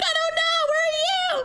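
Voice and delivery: very high, hammed-up panicked wail; mock panic. Customer replying to the lost driver by phone, mock panicked wail. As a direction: throw it back high and helpless, exaggerated. Acts out own frantic reply.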